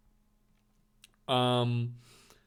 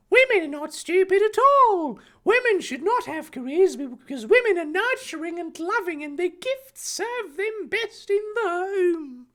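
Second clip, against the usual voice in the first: indignant shout. Acted. Harry answering Draco about women, indignant high put-on voice. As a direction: protest in a hammed-up high-pitched voice. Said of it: "Harry shouted indignantly".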